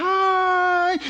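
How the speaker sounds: high, loud drawn-out yell; imitated howl of pain. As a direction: mimic a child howling "ai". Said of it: "Ela berra". (imitando um berro de dor, bem agudo)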